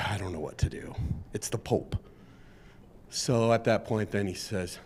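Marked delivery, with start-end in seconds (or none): 0.1-2.0 soft, helpless muttered aside; flustered chauffeur. Acted. Chauffeur unsure whether to let the Pope drive, softly helpless. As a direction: sound stuck between duty and the Pope.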